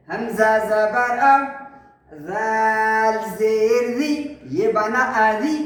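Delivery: slow chanted letter names; spelling drill recitation. Shown as a demonstration. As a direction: draw out each letter, then join the syllables.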